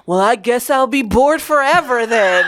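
loud, high, laughing mock lament; hammed-up kid's guilt trip; guilt-tripping nephew. Nephew refused his aunt's phone on the train, dramatic guilt trip.

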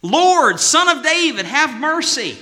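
high pleading cry; a desperate mother calling out for mercy; crying out woman. Acted. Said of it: "she's saying".

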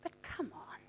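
the Holy Spirit softly calling a believer to listen; soft divine voice. Retold or imitated. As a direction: invite gently, almost whispered. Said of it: "the Holy Spirit is saying".

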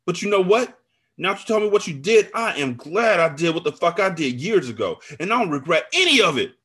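high, loud defiant declaration; angry retaliation. A narrator's reading. Friend hints at her own affair, angrily defiant. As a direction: hit it hard with spiteful defiance.

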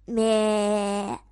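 low bleating sheep; 羊咩咩叫. Acted. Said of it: "羊说".

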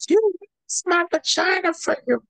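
very high squeaky put-on voice; mock squeal. Joking about the photo shoot, very high squeal.

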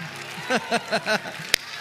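bright laugh of delight; joyful laugh. Real, not acted. Preacher laughs joyfully amid praise. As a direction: laugh out with joy.

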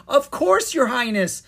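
very high, loud, eager acceptance; delighted cry. A narrator's reading. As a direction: cry out, overjoyed to be asked. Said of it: "Miranda cried".

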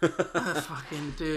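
drawn out slowly through a laugh. Real, not acted. Laughing at his co-host's joke about hating the movies, slow and amused. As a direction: laugh the words out, stretching them.

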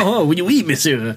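imitating a bad French audition read; mock French accent. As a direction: toss off a cartoonish French line. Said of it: "Can you do a French accent?".

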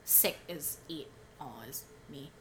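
ordinary talk

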